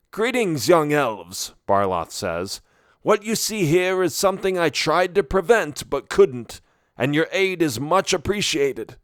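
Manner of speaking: put-on high old wizard voice, weary; a kindly wise wizard, regretful. A narrator's reading. Barloth voiced as figure.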